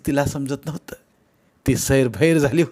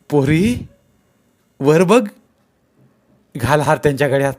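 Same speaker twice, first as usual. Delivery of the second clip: raised, louder elder's prompting; coaxing sarpanch; 3 semitones higher, 6 dB louder than usual. Acted. Sarpanch urging Renuka to garland the groom, coaxing call. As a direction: nudge the bride to look up.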